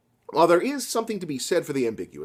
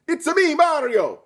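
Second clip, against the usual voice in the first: slow, much higher mock Italian; Mario impression; 13 semitones higher, 4 dB louder than usual. Soldier lazily switching to a Mario voice. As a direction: ham up a cartoon Italian plumber. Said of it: "You're not even trying now".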